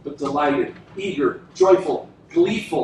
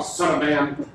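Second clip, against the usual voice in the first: usual speaking voice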